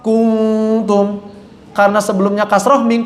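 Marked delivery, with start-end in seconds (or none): 0.0-1.2 kuntum with lips rounded from the damma, thick; wrong rounded kuntum; wrong pouted kuntum. Shown as a demonstration. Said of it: "domah jadinya apa".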